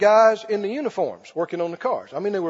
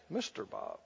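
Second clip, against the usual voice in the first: "Mister Bob" repeated much softer and lower, gently; 13 semitones lower, 19 dB quieter than usual. Retold or imitated. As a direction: ease the name out softly, almost to yourself. Repeating the name to the mechanic.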